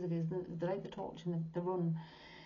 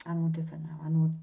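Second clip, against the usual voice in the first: usual speaking voice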